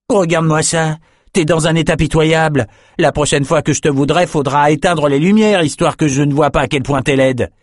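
put-on scornful young man. A narrator's reading. Amos rejects the beaten woman crawling to him, scornful. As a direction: sneer at her in revulsion.

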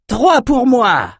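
very high, drawn-out cry; slow, exultant high yell; triumphant shout. A narrator's reading. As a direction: crow your tally across the field. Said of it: "cria Lies".

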